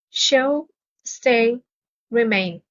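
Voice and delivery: ordinary talk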